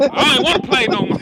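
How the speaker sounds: laughing. Real, not acted. Laughing while acting child.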